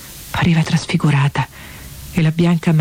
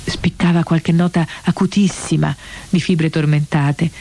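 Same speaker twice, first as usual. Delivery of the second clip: usual speaking voice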